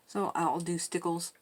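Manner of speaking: usual speaking voice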